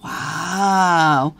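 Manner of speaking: ordinary delivery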